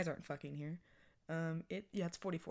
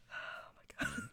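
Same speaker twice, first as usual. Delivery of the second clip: breathy whisper; 3 dB quieter, slower than usual. Real, not acted. Exhausted after sigh.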